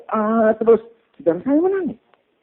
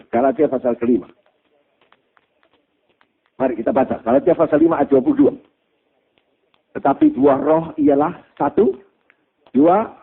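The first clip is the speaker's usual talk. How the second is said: ordinary delivery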